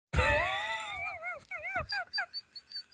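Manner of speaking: very high, shrieking burst of laughter. Real, not acted. Laughter after the punchline.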